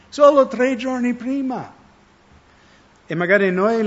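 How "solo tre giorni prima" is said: incredulous emphasis. Real, not acted. Marvelling at quick forgetfulness.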